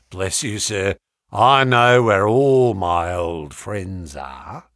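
slow, low sly threat; sinister old sailor. A narrator's reading. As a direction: menace behind a smile. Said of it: "with a sinister smile".

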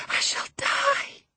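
very high, slow, breathy wail; failing desperate cry. Acted. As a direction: let the cry thin into breath. Dying woman's plea gives out.

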